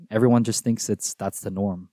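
ordinary talk, nothing marked

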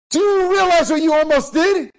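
loud, high outraged demand; acted furious uncle; angry scolding. Furious uncle confronts nephew.

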